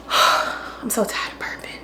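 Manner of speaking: weary whisper. Real, not acted. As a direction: breathe it out, worn out. Tired aside while cooking.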